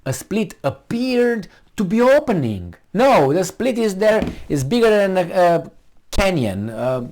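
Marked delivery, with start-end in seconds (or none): 0.1-2.7 higher, mock-dainty quoting of the article; mocking read. Shown as a demonstration. Mocking the article author's timid phrasing, high and prissy. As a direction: sneer at the timid wording. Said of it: "You see how softly she starts?".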